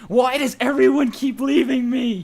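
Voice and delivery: high, loud put-on girlish whine; whiny girl voice. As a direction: wail it like a forsaken girl. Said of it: "the squiggly line is gonna be a girl voice".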